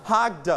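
"hugged" said hug-duh for learners; separated final d. Shown as a demonstration. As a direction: release the d of "hugged" as a little "duh". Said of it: "we pronounce it like".

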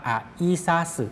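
"อะอิซาสึ" with "อะ" and "อิ" kept apart; slow pronunciation. Shown as a demonstration. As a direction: say "อะอิซาสึ" slowly, syllable by syllable. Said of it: "ออกเสียงช้าๆ ออกเสียงว่า". (ออกเสียง "อะอิซาสึ" ช้าๆ แยกพยางค์)

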